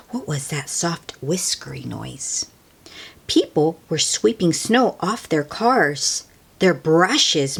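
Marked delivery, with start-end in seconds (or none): 0.1-2.5 whispered wonder; hushed curious narration. A narrator's reading. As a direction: whisper the question.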